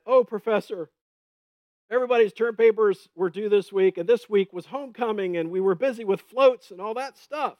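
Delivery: a student whining out excuses; whiny student excuse. Retold or imitated. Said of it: "They said".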